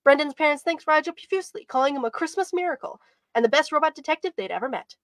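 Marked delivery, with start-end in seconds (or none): none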